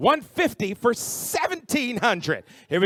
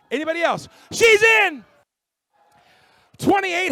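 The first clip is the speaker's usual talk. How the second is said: high, loud auctioneer shout; excited shout; 10 semitones higher, 6 dB louder than usual. Real, not acted. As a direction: call it out thrilled. Last call, new bid arrives.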